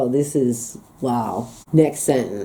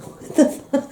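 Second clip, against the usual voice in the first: laughing whisper; 9 semitones higher, 3 dB quieter than usual. Real, not acted. Laughing at strange dialect phrase.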